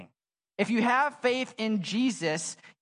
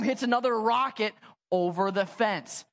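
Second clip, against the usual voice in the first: ordinary talk, nothing marked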